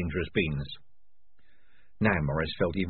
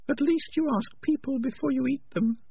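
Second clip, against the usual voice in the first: high, sharp little rat voice; Peaches voice. Acted. Peaches tells Morris, in a high rat voice. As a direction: squeak it out, much higher.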